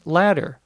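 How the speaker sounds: latter said just like "ladder". Shown as a demonstration. Teacher showing listeners that latter sounds like ladder, T like a D. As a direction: tap the double T of latter as a quick D. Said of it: "also pronounced in normal conversation".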